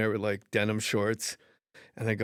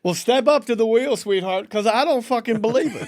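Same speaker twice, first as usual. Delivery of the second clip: loud, higher brash host voice; game show host; 9 semitones higher, 9 dB louder than usual. Retold or imitated. Host inviting Marge to the wheel, loud brash voice. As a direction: boom it out like a cheesy host. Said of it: "pretend he was the host".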